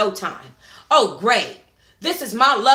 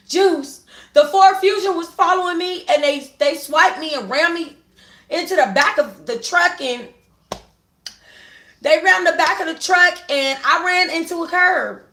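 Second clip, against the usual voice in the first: high, loud, panicked rush of words; a terrified driver telling her friend what happened; hysterical; 7 semitones higher, 3 dB louder than usual. Acted. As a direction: blurt it out frantically, barely breathing. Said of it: "I was so hysterical".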